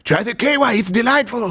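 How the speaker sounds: high, put-on pitchman voice; mock salesman.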